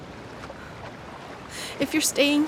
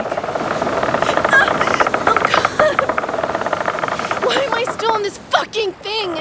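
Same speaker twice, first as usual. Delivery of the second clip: sudden terrified cry; panicked scream; 7 semitones higher, slower than usual. Acted. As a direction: gasp and cry out in fear. Rope pulls her again.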